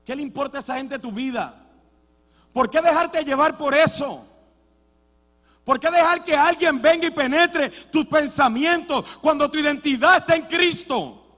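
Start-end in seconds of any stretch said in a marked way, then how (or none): 2.5-11.2 loud, driving pulpit preaching; fiery sermon climax; preaching at full force. Acted. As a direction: hammer the question home to the church.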